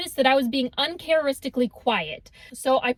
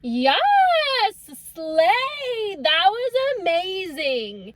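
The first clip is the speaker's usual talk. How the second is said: higher, slow mock cheer; 5 semitones higher, much slower than usual. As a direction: cheer like a fan. Friend cheering from seat.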